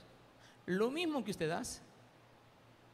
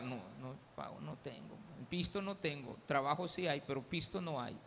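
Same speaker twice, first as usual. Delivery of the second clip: much softer, lower, sheepish refusal; mock mumble; 5 semitones lower, 6 dB quieter than usual. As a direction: mumble it away as if embarrassed. Pastor voiced refusing to pay.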